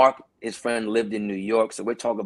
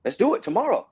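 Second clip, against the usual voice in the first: much higher, eager "let's do it tomorrow"; agreeing to meet Carl, high mock eagerness; eager yes; 11 semitones higher than usual. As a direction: jump at the chance. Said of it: "I'm like".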